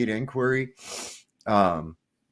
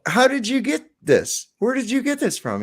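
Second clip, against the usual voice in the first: much higher, louder excited questions; excited bartender; 13 semitones higher, 4 dB louder than usual. Acted. Bartender quizzes the customer, excited and high. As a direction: pile the questions on in wonder. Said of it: "He said".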